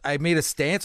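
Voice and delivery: ordinary talk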